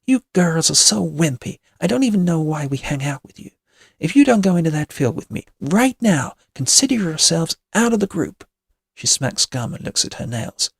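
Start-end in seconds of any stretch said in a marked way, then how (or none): 0.1-8.5 put-on louder, higher mean-girl ultimatum; bullying. A narrator's reading. Witch threatens to drop her friends, bullying. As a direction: pressure them with scornful emphasis.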